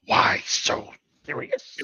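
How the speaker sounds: slow, whispery villain voice; breathy menacing Joker whisper; Joker impression. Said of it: "I used to mimic Heath Ledger's Joker".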